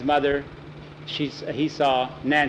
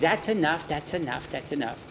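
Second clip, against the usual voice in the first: fading out; 6 dB quieter than usual. Acted. Calming the licking dog.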